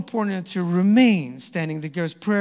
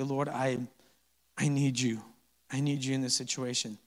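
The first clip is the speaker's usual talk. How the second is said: humble quiet prayer; 4 semitones lower, 9 dB quieter than usual. Acted.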